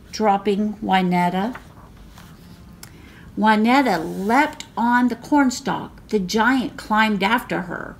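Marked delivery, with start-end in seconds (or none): none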